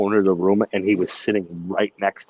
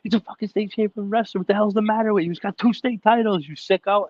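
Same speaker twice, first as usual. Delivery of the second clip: very high-pitched mock tirade; retold screaming; 11 semitones higher than usual. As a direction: scold Jerry in a put-on, worked-up yell. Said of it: "I started screaming at him".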